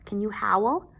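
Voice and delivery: plain reading voice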